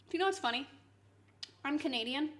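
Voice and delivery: ordinary delivery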